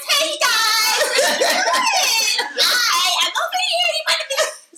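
high, loud squeaky kitten voice; baby kitty voice. Retold or imitated. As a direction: chirp it like a tiny kitten. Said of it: "she goes into this little baby kitty voice".

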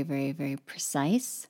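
ordinary talk

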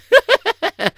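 high-pitched chuckle; amused laugh. Real, not acted. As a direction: giggle at your own joke. Laughs at his own joke.

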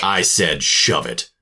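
angry. Acted. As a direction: lay down the law, slowly. Repeats angry dismissal.

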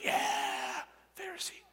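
high, airy shouted cheer; a fan's yell for their hero. Retold or imitated. The crowd hailing the Pharisee with a high shouted cheer. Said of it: "And they're like".

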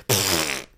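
blown-out "pfft" of spitting a drink; mimed spit-take. Retold or imitated. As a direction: splutter out a sip in disgust. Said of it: "drank from [name]'s water and then went".

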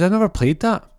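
put-on high-pitched little boy voice; young boy. Benji answers Jimmy about the game, high child voice. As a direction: innocent kid piping up loudly. Young son's line in acted story.

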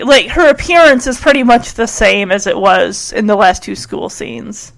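ordinary delivery

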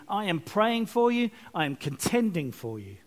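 ordinary talk

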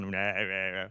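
low, mumbled mock refusal; dismissive brush-off. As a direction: imitate a man waving the offer away. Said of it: "like that".

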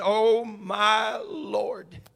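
drawn-out, slow scripture plea; slow pleading. Acted. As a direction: stretch each word like a plea. Mother's plea read slowly.

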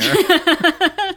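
loud burst of laughter; big laugh. Real, not acted. Hosts laughing at the time joke.

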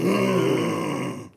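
high, loud, drawn-out hum of pleasure; a mock blissful mmm; pleasured moan. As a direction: moan like savouring a scent. Savouring the smell afterward.

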